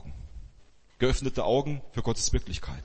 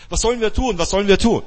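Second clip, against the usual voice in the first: higher and louder, frantic repeated question; frightened servant's alarmed outburst, much higher and louder; panicked servant; 8 semitones higher, 8 dB louder, faster than usual. Retold or imitated. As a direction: cry out in panic at the surrounding army. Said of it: "bekommt er Panik".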